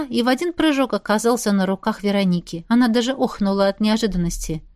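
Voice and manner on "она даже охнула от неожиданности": usual speaking voice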